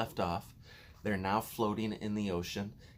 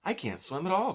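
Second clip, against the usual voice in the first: high, louder frightened cry; 11 semitones higher, 5 dB louder than usual. A narrator's reading. Ladybird cries out in fear of drowning. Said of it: "cried the ladybird".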